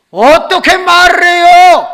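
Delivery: high, loud, drawn-out objection; exasperated protest. Retold or imitated. Student answering pastor about newborns, loud slow protest. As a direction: cry out in mock disbelief.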